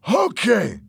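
exasperated loud agreement; loud giving in. A narrator's reading. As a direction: call it out to stop him. Fernando gives in under threat.

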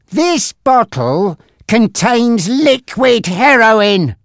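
high, slow, emphatic menace; angry. A narrator's reading. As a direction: spell out the threat angrily. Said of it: "Frankie said angrily".

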